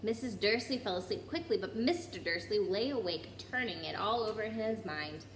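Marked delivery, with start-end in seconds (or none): none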